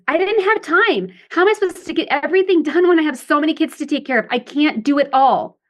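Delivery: high, whiny overwhelmed wife; adaptive child. Shown as a demonstration. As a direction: complain like a victim who can't cope. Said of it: "sounds more like the adaptive child".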